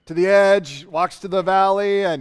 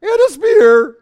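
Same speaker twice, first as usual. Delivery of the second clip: much higher, loud cracking squeak; the boy's voice cracks mid-taunt; voice breaking; 12 semitones higher, 9 dB louder than usual. Acted. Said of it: "it's right there, it's changing".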